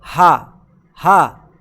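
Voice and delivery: हा breathed out low and loud; low breathy ha drill; phonic h sound. Shown as a demonstration. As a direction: push out हा with air. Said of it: "H को क्या पढ़ते हैं".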